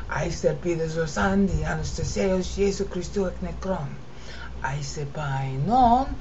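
normal talking voice

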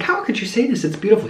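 high-pitched imitation of wounded protest; mock upset. Retelling him defending The Notebook, mock upset. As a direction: act out his appalled defense of the film. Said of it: "he got mad at me".

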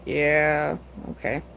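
low, soft voicing of an onlooking dog; a hint of a laid-back dog; lightly voiced dog. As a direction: quietly speak for the watching dog, unimpressed. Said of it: "just watching like".

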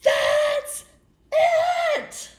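high, loud shouting tantrum; furious pigeon. Acted. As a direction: stamp your feet with the voice. Pigeon yells and hoards.

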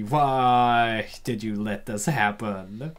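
ordinary talk

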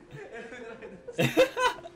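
high burst of giggling; real laugh. Real, not acted. Viewer amused by a piggyback task, high giggling. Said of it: "I don't know why I'm laughing".

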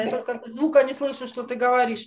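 normal talking voice

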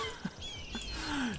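soft, high laugh; helpless laugh. Real, not acted. Player amused by the dodos, helpless laugh. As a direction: laugh it out after a shock. Laughs after the dodo shock.

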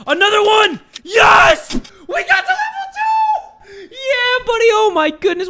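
very high, loud victory squeal; shrieking celebration with laughter; ecstatic screaming. Real, not acted. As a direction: scream it in overjoyed disbelief. Reaches level two, celebrating.

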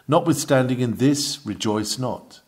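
normal talking voice

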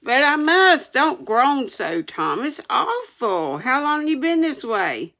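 high, pleading alarm; panicked concern. A narrator's reading. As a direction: plead anxiously with a sufferer. Sid panics over Tom's groans.

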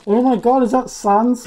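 high, loud excited outburst; mock astonishment. Player spotting Sans, loud hammed-up excitement. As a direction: burst out in overblown recognition.

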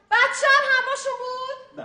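high, loud aghast question; can't believe what she hears; wife repeating the news, high and loud in horror; shocked disbelief. Acted. Shocked repeat about the child.

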